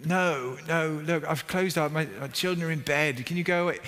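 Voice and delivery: a lightly played tired refusal; grumpy refusal. Sleepy neighbour telling the knocker to leave, mock grumpy.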